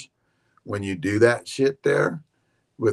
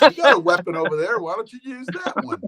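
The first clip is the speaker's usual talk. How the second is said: mock high teasing voice, louder then higher; hammed-up much higher teasing impression; teasing friend; 11 semitones higher, 5 dB louder than usual. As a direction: rib him like his buddy would.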